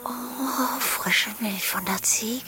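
breathless whispered echo; soft rapt whisper; dreamy whisper. Acted. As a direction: whisper it back, lost in the dream. Echoes lover's words, dreamy whisper.

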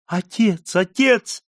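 high, desperate calls; crying out. A narrator's reading. Margarita calling her father in despair, crying out. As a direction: cry out twice, climbing in pitch. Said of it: "кричала Маргарита".

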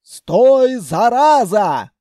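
loud, high, drawn-out yell; angry shouting. A narrator's reading. Grandmother chasing the bolting throne, angry shouting. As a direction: holler a curse after a runaway. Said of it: "кричала бабушка".

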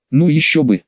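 ordinary delivery, nothing marked

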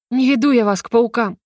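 plain reading voice

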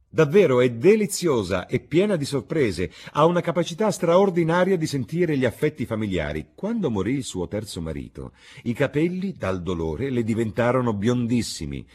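high, loud gushing mock praise; witty lord, animated. Lord praising a much-married lady, animated.

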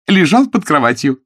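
high, loud and merry; cheerful announcement. A narrator's reading. Jane reports where she found frightened Bream, cheerfully. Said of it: "весело объявила она".

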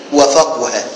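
وفقه said "wa-faquha", with u on the middle qaf; middle vowel u. Shown as a demonstration. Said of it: "وضم العين".

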